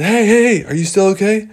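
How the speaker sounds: high, loud rousing "hey"; urgent concern. Acted. Rescuers checking on frozen Captain Hook, urgent.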